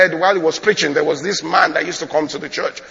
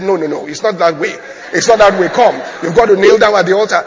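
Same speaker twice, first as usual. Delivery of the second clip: acted pastor correcting, getting louder; insistent objection; 3 semitones higher, 7 dB louder than usual. Pastor stops the man leaving the altar, insistent. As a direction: build to a loud, hurried command.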